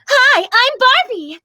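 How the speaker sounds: high, loud character greeting; Barbie. Acted. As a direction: introduce yourself as a high-pitched toy doll. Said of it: "People wanted to hear that".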